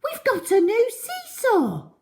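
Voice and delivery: high, bright cry of delight; children crying out over the new seesaw; excited children. A narrator's reading. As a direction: squeal it like thrilled kids. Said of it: "they cried".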